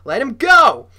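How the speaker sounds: very loud, high command; commanding shout. A narrator's reading. Stranger orders bully to release Noah, shouting. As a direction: bellow the order at full force. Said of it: "sounded too old and gravelly".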